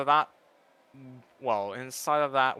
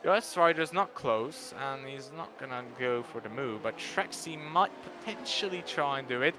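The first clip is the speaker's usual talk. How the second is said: ordinary talk, nothing marked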